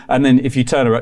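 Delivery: ordinary talk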